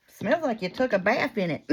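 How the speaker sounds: retold bystander's jab. Man behind customer teasing her, amused mock heckle.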